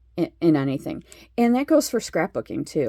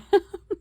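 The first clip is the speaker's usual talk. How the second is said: short laugh; 14 semitones higher, 4 dB louder than usual. Real, not acted. Laughs at her own yawning.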